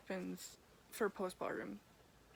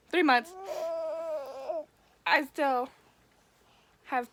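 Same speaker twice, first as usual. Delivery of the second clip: emphatic upset; 5 semitones higher, 6 dB louder than usual. Real, not acted. Emotional about lasting pain.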